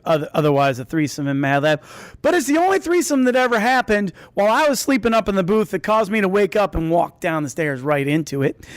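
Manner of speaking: ordinary talk, nothing marked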